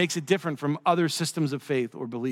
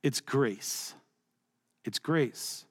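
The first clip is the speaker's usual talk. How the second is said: soft, lower, with a pause between; hushed simple answer; 4 semitones lower, 7 dB quieter, much slower than usual. Acted. Lewis answers the debating scholars, hushed and simple. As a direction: drop to a quiet, understated punchline.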